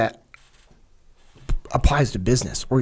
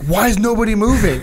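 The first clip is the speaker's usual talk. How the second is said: mock exasperation; 8 semitones higher, 5 dB louder than usual. Retold confused leader.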